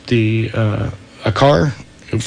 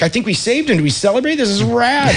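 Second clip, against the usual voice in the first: higher-pitched, hopeful elation; retold excitement; 8 semitones higher than usual. Lifeguards to each other after a rescue, retold excitement. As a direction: lift the pitch as if replaying a victory.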